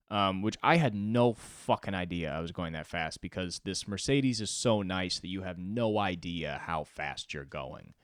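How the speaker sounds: ordinary delivery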